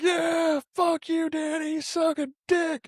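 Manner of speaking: high yelling taunt, slowing at the end; mock triumphant shout. Friend quoting Franco yelling at Danny while rising. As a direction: yell it up high in gloating triumph.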